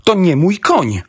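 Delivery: high, agitated outcry; exclaiming. A narrator's reading. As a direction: cry out in protest. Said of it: "wykrzyknął Ross". (okrzyk protestu, wysokim głosem)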